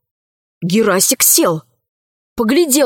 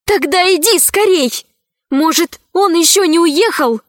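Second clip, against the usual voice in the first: взволнованно; 7 semitones higher than usual. A narrator's reading. Urging the dragon.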